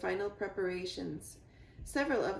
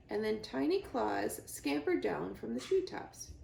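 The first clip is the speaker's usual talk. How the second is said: plain reading voice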